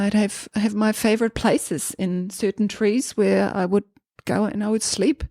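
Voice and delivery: ordinary talk, nothing marked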